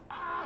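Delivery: a pained cry squeezed out up high; high mock yell of pain. Stung by yellow jackets on the cliff, a high mock yelp. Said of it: "I'm yelling".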